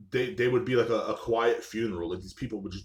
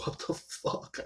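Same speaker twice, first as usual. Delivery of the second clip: breathy stunned whisper, half to himself; whispered what the fuck; 6 dB quieter, slower than usual. Retold or imitated. Caught off guard by an ex-girlfriend's message, whispering. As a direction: drop to a disbelieving whisper.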